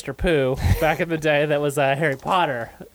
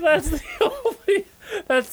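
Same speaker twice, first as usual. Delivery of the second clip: high and slow, still laughing; slower than usual. Real, not acted. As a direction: push the words through laughter. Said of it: "can't stop laughing".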